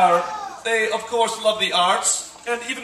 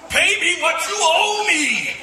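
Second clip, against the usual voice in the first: laughing demand; 8 semitones higher than usual. Acted. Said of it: "choked the other servant, saying".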